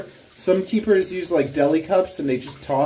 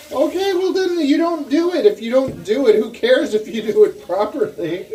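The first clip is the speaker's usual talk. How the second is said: exasperated retort; 10 semitones higher, 7 dB louder than usual. Real, not acted. Arguing playfully with wife.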